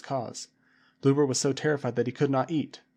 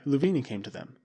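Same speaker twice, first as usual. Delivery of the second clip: usual speaking voice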